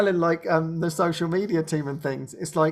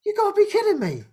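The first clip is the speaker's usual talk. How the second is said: disbelief; 11 semitones higher, faster than usual. Real, not acted. Disbelief at free room offer.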